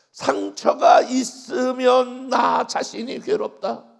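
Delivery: a slow, booming preacher's cry; slow shouted realization. Real, not acted. Preacher to congregation, slow booming shout. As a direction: bellow it out slowly, every word weighted.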